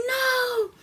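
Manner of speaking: high cry of alarm; horrified cry. Retold or imitated. Woman sees her husband about to be shot, high horrified no. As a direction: cry out to stop him. She cries out as he shoots.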